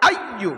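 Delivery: আইয়ু punched with a bouncing accent; forceful stress. Shown as a demonstration. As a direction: hit আইয়ু like a ball bouncing up. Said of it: "জোর দিয়ে বলতে হবে".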